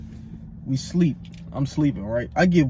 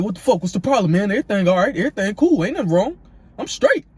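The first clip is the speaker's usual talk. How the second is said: raised, high-pitched indignant imitation; mock indignant roommate; 6 semitones higher, 4 dB louder, faster than usual. Roommate answering worried neighbours at the door, mock indignant. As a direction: protest loudly as the roommate, climbing higher.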